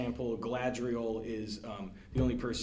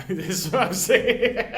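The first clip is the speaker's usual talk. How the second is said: loud, slow, high words through laughter; laughing; 11 semitones higher, 13 dB louder than usual. Real, not acted. Contestant laughing at missing yet another question, loud and high. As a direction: laugh at your own failure.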